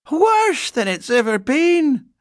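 slow, loud, high grumble; complaining housekeeper. A narrator's reading. Housekeeper moaning about her rheumatism, complaining.

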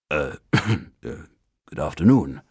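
unsure man answering a bear; doubtful. A narrator's reading. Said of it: "replied Mister Brown doubtfully".

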